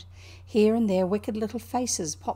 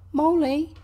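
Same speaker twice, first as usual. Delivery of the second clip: calling out; 7 semitones higher, 7 dB louder than usual. A narrator's reading. Rat calling for Mole in the empty house, calling out. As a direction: raise the voice to call across the house. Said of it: "Then he called".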